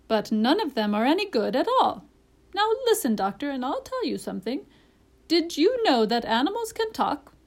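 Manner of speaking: high, emphatic bird voice; parrot, confiding. A narrator's reading. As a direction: win the doctor's attention with swooping pitch.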